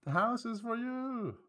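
high, slow, drawn-out playful call; high mock call. Player offering the house in the joke, high drawn-out call. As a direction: hold it up high and stretch the ending. Mock calling out in meme bit.

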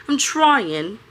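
ordinary delivery

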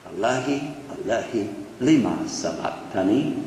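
low, soft and solemn; hushed quotation. Acted. Preacher repeating the cry for listeners to think about, hushed. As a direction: let the cry sink down quietly.

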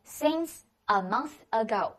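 each word spelled out slowly for the class; slow it right down so students can copy; slow repeat. Shown as a demonstration. Teacher repeats the phrase very slowly for students. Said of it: "叫做since a month ago".

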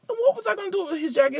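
high, quick disbelieving aside; incredulous aside. Real, not acted. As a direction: toss it off in disbelief. Rhetorical aside, high and fast.

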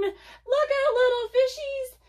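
high, loud warning cry to the little fish; warning shout. Acted. Narrator warns the little fish, high loud shout. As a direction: call out to warn the fish.

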